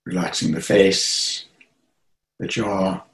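unhurried meditation guidance with long pauses; guided meditation. Acted. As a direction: guide listeners gently into relaxation, phrase by phrase.

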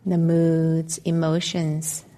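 calm, measured meditation voice with long pauses; guided meditation. Acted. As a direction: guide listeners gently into stillness, leaving space between phrases.

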